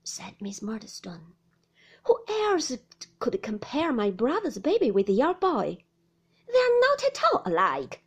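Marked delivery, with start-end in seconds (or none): 2.1-8.0 loud indignant outburst, jumping high; furious raised voice; in a passion. A narrator's reading. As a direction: rail at her in outrage. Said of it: "into a passion".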